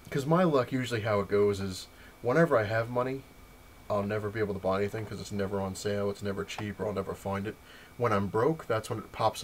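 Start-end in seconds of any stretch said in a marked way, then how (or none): none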